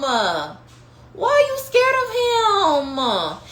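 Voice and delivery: slow, high, voice breaking with emotion; a preacher's tearful, slow cry of disbelief; near tears. Real, not acted. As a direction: plead with them, close to crying. Preacher pleading with congregation.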